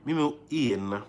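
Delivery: ordinary talk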